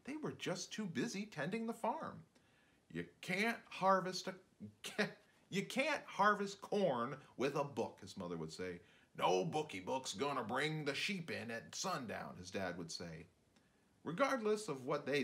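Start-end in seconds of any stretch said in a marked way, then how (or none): 2.9-8.0 higher, slow scolding mother; a touch of a no-nonsense mum; mild mock scold, drawn out; lightly voiced farm mother. 9.2-12.4 gruff-ish farmer put-down, a touch of it; a hint of a practical father scoffing; mild mock dismissive dad; lightly voiced farmer dad.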